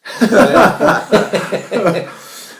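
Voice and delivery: big burst of laughter; laughing hard at the punchline; loud laugh. Real, not acted. Reacting to worst decision.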